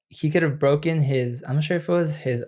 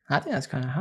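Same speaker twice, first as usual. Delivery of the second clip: normal talking voice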